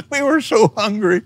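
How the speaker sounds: high, laughing aside; amused, pitch starting high and settling. Real, not acted. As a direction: chuckle through the words at the memory. Laughs at his own memory.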